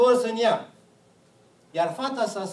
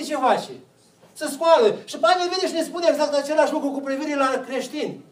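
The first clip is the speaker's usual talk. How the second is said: normal talking voice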